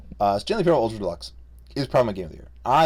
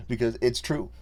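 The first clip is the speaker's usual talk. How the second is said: ordinary talk, nothing marked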